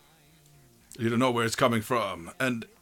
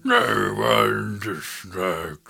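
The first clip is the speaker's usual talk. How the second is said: slow lightly demonic voice; 3 semitones lower, much slower than usual. Acted. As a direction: drag it out like a mild demon. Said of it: "more like a demonic voice".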